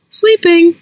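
a pheasant parroting back; chirpy bird echo. Acted. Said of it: "echoed Pheasant".

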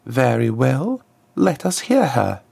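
high, smooth voice of a formal diplomat; a smooth ambassador, pitched well up; smooth contralto ambassador. A narrator's reading. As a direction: address royalty smoothly in a high-set voice. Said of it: "her smooth contralto echoing in the hall".

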